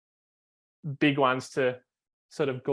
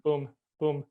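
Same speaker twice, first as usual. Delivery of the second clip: short, even beats like quick little pumps; quick rhythmic booms; slower than usual. Shown as a demonstration. Coach shows the student a quick short pumping rhythm, steady staccato booms. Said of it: "change to a little".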